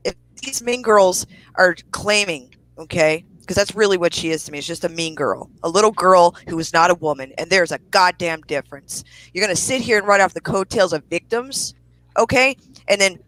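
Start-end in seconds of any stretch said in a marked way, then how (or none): none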